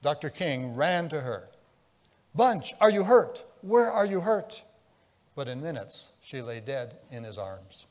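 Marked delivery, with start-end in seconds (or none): 2.3-4.6 frantic husband. Retold or imitated. Husband runs to shot wife.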